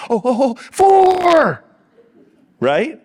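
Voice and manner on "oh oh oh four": high, loud, drawn-out mock yell; hammed-up panicked golfer, slow and shouted; golfer yelling fore. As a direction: call a wild shot across the course. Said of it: "the guy yelling".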